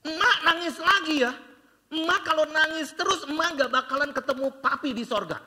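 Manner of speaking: mock child sermon to grandma, amused; child preaching to grandma. Great-grandchild comforting crying grandma, lightly voiced child preacher. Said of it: "ini anak kecil atau pendeta sih".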